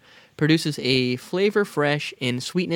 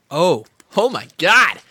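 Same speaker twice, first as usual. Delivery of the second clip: loud, high, choked gasp of disgust; real recoil after a harsh swig; disgusted outcry; 4 semitones higher, 7 dB louder, slower than usual. Real, not acted. Gagging on straight liquor.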